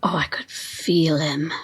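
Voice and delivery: rapt whisper. Acted. Carl describing feeling Wesley, in a rapt whisper. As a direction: breathe it like a confession. Follower describes the leader's pull.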